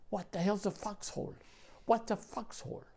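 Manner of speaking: high-pitched, baffled mimicry; clueless questioning. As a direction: imitate a clueless kid, pitched well up. Clueless newcomer asks about foxholes.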